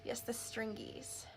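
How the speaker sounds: slow, hushed mock-secret; playful whisper. Real, not acted. Echoes co-host about glue stringies in a playful whisper. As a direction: breathe it like a silly secret. Playfully echoing co-host's word.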